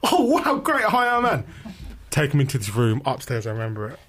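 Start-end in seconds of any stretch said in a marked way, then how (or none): 0.0-1.5 very high, gushing introduction; hammed-up high-pitched delight; gushing greeting. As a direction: gush with squeaky enthusiasm. Interviewer's gushing greeting acted.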